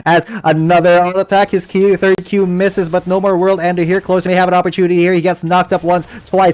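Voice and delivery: brisk play-by-play call; esports caster. Real, not acted. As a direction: narrate the fight live. Live play-by-play.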